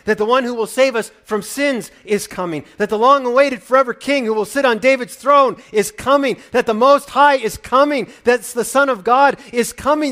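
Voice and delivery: loud, high preacher's build-up; preaching at full force. Acted. Preacher stirring the congregation, at full force. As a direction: raise the voice line by line to a peak.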